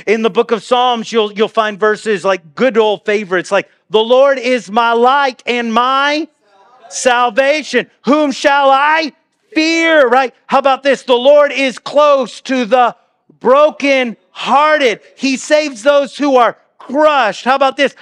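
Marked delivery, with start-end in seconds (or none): 3.9-10.1 proclaimed scripture. Acted. Preacher proclaiming favorite psalm verses. 11.0-17.4 loud, slow, high preaching of the verse; proclaimed scripture. Acted. Preacher proclaiming favorite psalm verses.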